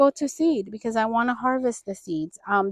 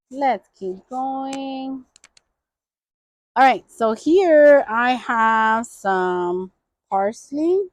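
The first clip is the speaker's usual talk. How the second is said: ordinary talk, nothing marked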